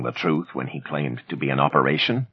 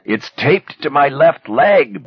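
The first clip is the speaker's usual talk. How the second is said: slow, higher, louder desperate cry; 10 semitones higher, 5 dB louder, slower than usual. A narrator's reading. Bassett cries out the hiding place to Manny. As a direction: cry it out in pain. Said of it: "Bassett cried".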